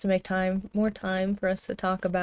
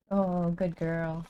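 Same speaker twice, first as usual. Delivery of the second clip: soft praising dog; 3 semitones lower, 5 dB quieter, much slower than usual. Real, not acted. Praising dog after shake.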